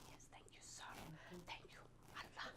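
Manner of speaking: breathless whisper of relief; barely voiced thanks to God; relieved whispered thank you to Allah.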